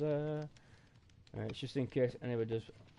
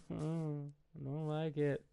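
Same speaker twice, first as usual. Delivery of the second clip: usual speaking voice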